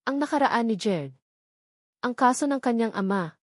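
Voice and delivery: usual speaking voice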